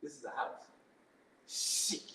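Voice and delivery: whispered in awe; breathless disbelief, barely voiced; awed whisper. Stunned at being given a house, hushed whisper.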